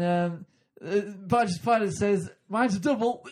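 loud, high, hesitant club comic; hammed-up pub joke teller fumbling the set; bad northern comedian. Comic parodies a struggling club comedian, loud and high. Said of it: "mentally ill comedian from the north".

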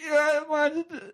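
loud, high, hesitant club comic; hammed-up pub joke teller fumbling the set; bad northern comedian. Comic parodies a struggling club comedian, loud and high. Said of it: "mentally ill comedian from the north".

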